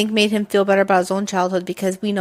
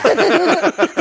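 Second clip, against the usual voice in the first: high real laugh; 9 semitones higher, 5 dB louder than usual. Real, not acted. Laughing as her friend retells the movie. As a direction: laugh out loud at the memory.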